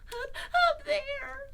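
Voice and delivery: high, slow, stammering squeak; frightened Piglet. A narrator's reading. Piglet waving his paw toward the trap, high frightened stammer. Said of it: "said Piglet".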